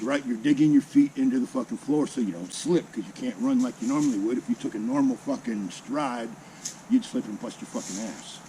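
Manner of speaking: plain reading voice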